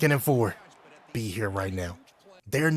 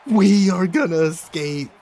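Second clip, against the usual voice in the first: excited; 4 semitones higher, 7 dB louder than usual. Real, not acted. Closing out the win.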